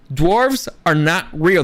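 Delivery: slow, every word weighted; slow emphatic stress. Real, not acted. Insisting to viewers that dwarves are fictional, slow and emphatic. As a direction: lay each word down slowly, hard. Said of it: "I can't say that enough".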